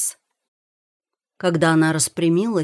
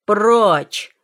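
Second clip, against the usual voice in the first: loud, higher shout; roared command; 4 semitones higher, 8 dB louder than usual. A narrator's reading. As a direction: roar them out of the way. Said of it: "заревел Ран Мин". (громкий рёв)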